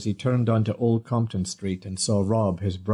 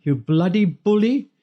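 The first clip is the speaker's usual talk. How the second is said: put-on high, louder old lady's shout; angry woman's cry; 10 semitones higher, 4 dB louder than usual. A narrator's reading. Dinah yelling at Rob as she attacks, high angry shout. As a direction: scold the bully with fury. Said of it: "a woman's voice raised in anger".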